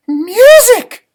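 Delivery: high loud burst; excited exclaim. Acted. Gingerbread man shouting to the class, excited. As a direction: blurt out the discovery. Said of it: "I whispered".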